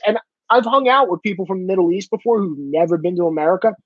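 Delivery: normal talking voice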